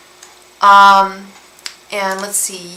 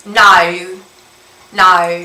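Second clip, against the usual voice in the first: loud "No", wide Aussie diphthong; Australian "no"; 6 dB louder than usual. Shown as a demonstration. Asking Australian viewer to check, Aussie "No". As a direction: say "No" like an Australian, nah-oo. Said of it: "is that how you guys say no".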